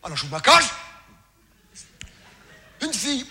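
ordinary talk, nothing marked

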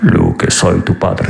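deep, slow mock Spanish Vader; a hammed-up Spanish Darth Vader. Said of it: "Dark Vador s'exprime dans la langue du reggaeton".

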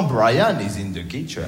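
put-on well-behaved pupil reciting in French-accented English; obedient pupil. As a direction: answer dutifully like a good little pupil. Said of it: "tu répondais sagement".

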